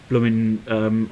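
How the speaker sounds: normal talking voice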